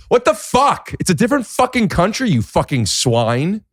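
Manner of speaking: high-pitched indignant rant; hammed-up fury, pitch shooting up; mock outrage. Acts outraged reply to being confused.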